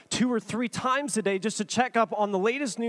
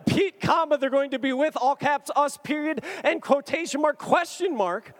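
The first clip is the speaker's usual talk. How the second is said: high-pitched punctuation read-back; mock incredulous; 6 semitones higher than usual. Writing back to Dan, mock incredulous. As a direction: quote it back in excited disbelief. Quotes reply back in disbelief.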